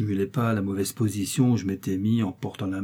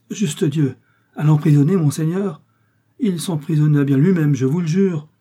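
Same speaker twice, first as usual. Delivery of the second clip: raised, louder, indignant outcry; alarmed protest; 5 semitones higher, 6 dB louder than usual. Acted. Innkeeper protesting to D'Artagnan, loud and raised. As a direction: insist it loudly, hands up.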